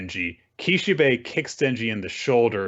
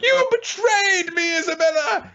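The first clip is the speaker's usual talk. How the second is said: hammed-up, very high villain wail; betrayed outcry; 3 dB louder than usual. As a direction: cry out in mock betrayal, drawn-out and high.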